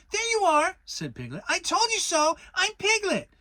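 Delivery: little piglet proving his point; triumphant Piglet. A narrator's reading. Piglet crowing to Kanga that he was right, high squeak.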